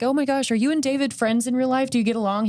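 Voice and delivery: lightly gushing, like a convention fan; imitating eager fans. Voices eager fans questioning actor.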